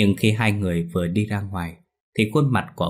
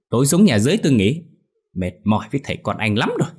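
high, loud irritated rant; hot-tempered scolding; 6 semitones higher, 4 dB louder than usual. A narrator's reading. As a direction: turn the tirade on the son. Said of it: "tính của bà ta cũng nóng như lửa". (nóng nảy mắng con)

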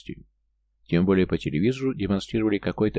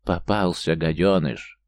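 ordinary delivery, nothing marked

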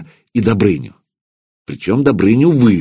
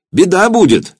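alarmed cry; 11 semitones higher, 4 dB louder than usual. A narrator's reading. Nephew cries out in alarm.

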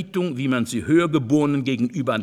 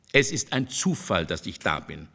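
low, quiet coldness, sinking at the end; understated cold reply; 8 semitones lower, 4 dB quieter than usual. A narrator's reading. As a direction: coolly dismiss it, voice dropping. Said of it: "sagte Casanova kalt".

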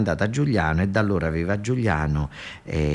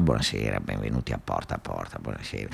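much lower, hushed TV-host voice; Bruno Vespa impression; 14 semitones lower, 9 dB quieter than usual. [name] doing Bruno Vespa at the door, low and hushed. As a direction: croon a deep, soft talk-show welcome. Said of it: "fa Bruno Vespa".

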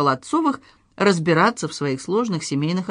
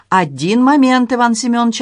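brushing him off; 5 semitones higher, 6 dB louder than usual. A narrator's reading. Maître d' stalling the police captain, brushing him off. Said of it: "отмахнулся от него метрдотель".

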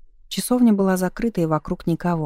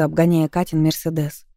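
ordinary delivery

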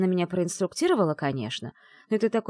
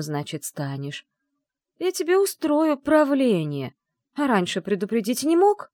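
higher, louder, slow mock threat; mock indignant threat; 7 semitones higher, 5 dB louder than usual. Bride scolding her dragon groom, mock threat. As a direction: scold him half-playfully.

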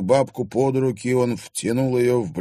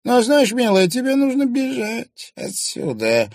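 high, louder crone with a spiteful edge; spiteful old crone; 13 semitones higher, 5 dB louder than usual. A narrator's reading. Old woman warns the girl, high and spiteful. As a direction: warn her off like a nasty old hag. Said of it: "злобно заявила старуха".

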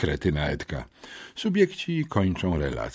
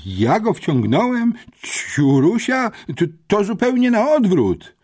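put-on much higher, louder indignant boy; outraged outburst; 11 semitones higher, 6 dB louder than usual. A narrator's reading. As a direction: explode at the injustice. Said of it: "wybuchnął". (wybuch oburzenia, wysoko i głośno)

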